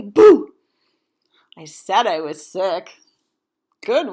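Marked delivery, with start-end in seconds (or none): 0.0-0.5 a loud, higher ghostly shout; jump-scare the kids with it; spooky boo. Pretend. Gravestones spooking the children, loud playful boo.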